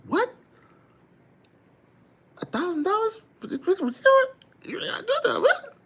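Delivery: high, disbelieving squeal of joy; squeaky delight over the money; excited disbelief. Retold or imitated. Recalling the thousand-dollar sale to Bella, squeaky excited disbelief. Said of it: "I got excited off of that".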